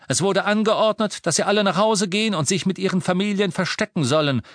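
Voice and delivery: loud official proclamation; announcing. Acted. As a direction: address the crowd formally. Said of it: "verkündete Harry".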